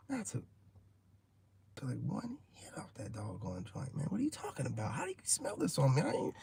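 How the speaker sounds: hushed denial whispered under breath; whispered protest. Retold or imitated. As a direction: whisper like a secret confession. Protests he barely smoked.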